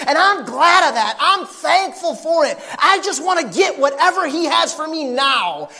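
building to a loud climax from the pulpit; full-force preaching. Acted. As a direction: bring it home to the congregation at full cry.

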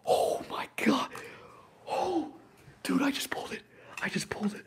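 high, loud, breathy disbelief; breathless amazement. Real, not acted. Streamer realizes he pulled the wanted card, high breathless amazement. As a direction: gasp it out, overwhelmed. Overcome by rare card pull.

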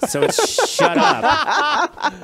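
squeaking the words out through a laugh, high and loud; can barely get it out for laughing; giggling high-pitched punchline. Real, not acted. Laughing mid-joke.

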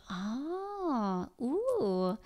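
soft, drawn-out sounds of interest; interested listening response. Shown as a demonstration. Parent listening to a child talk, softly intrigued. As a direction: respond softly with rising-and-falling wonder.

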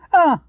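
sudden high loud yelp; a kid yelping when his dad asks where he went, caught out; nervous high yelp. Retold or imitated. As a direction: yelp like you've been caught.

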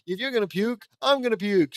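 high, loud panicked scream. Acted. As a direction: shriek it back in disgust. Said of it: "screams Hank".

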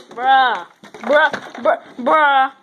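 loud, repeated bruh; exasperated bruh. Real, not acted. Reacting to failed flip.

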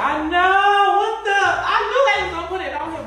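excited. Real, not acted. Excited outburst over movie price.

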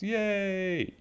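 high, drawn-out cheer; light storybook hooray, held high. A narrator's reading. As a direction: cheer on one long high note. Reader voices the clapping crowd's cheer.

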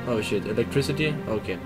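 normal talking voice

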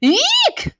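very high, loud scared squeal; shriek. Acted. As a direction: shriek in terror. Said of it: "they shrieked".

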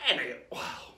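ordinary delivery

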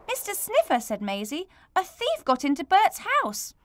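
put-on high excited child blurting news. Maisie rushing to tell Mister Sniffer. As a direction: burst out with the news. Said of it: "all excited".

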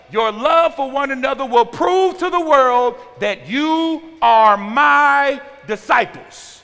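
loud, high, stretched-out preaching; forceful scripture reading. Real, not acted. Preacher reading John to the congregation, loud emphatic proclamation. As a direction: proclaim it to the whole room, phrase by phrase.

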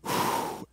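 long unvoiced outbreath sigh; released sigh. Shown as a demonstration. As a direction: breathe out to let it all go. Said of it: "and I'd go".

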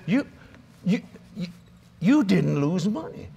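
imitating a dumbfounded financial guru; stunned disbelief. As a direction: stammer it out, stretching the words slowly. Guru stunned he lost no money.

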